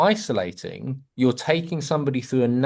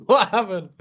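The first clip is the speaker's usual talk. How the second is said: startled surprise; 11 semitones higher than usual. Real, not acted. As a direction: burst out at the sudden fireworks. Sudden sound effects on call.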